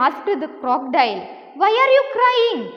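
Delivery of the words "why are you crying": high, loud storybook question; startled question. Acted. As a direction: voice the crocodile's startled concern. Crocodile asks his crying wife. (ഉയർന്ന സ്വരത്തിൽ പകച്ച ചോദ്യം)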